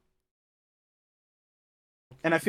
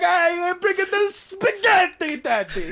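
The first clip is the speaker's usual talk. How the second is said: much higher, slow, hammed-up Italian kid; shitty Italian accent; 7 dB louder, slower than usual. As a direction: squeak it out like a cartoon Italian child, laughing. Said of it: "shitty Italian accents".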